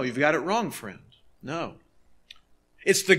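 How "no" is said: normal talking voice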